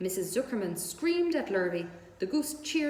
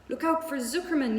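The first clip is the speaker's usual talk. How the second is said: higher, louder warning yell; gander yelling; 6 semitones higher, 4 dB louder than usual. A narrator's reading. Gander warning Wilbur about Zuckerman. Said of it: "yelled the gander".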